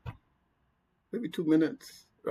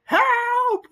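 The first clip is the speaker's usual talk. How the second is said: very high, loud imitated scream for help; panicked scream; 6 dB louder than usual. Imitating the trapped coworker screaming for help, shrill yell. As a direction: shriek it like a man losing it in a tiny box. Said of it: "he started screaming".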